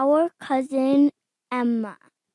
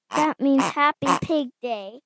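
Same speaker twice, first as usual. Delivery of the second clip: plain reading voice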